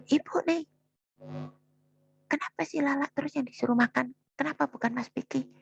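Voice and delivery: high-pitched snapping. Retold or imitated. Daughter snapping at her mother about being told to eat, a high indignant outburst. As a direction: throw it back at her like a sulky, indignant child. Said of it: "dengan nada tinggi bentak ibu".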